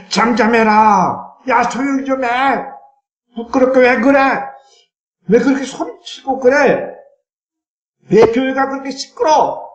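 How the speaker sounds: scornful high scolding voice; scolding crowd. Acted.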